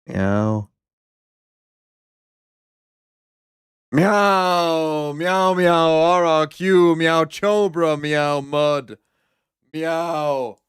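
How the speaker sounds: settling back into lazy cat meows. Pretend. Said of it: "That's as a cat".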